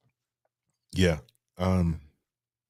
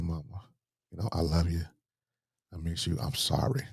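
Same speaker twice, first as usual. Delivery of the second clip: soft farewell; 7 dB quieter than usual. Retold or imitated. Son's farewell at mother's bedside.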